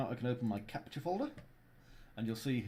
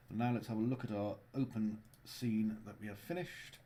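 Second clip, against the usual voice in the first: ordinary talk, nothing marked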